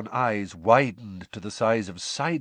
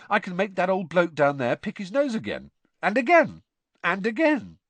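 amused; 8 semitones higher, 4 dB louder than usual. A narrator's reading. Ron amused by replay.

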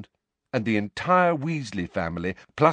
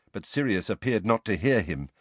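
ordinary delivery

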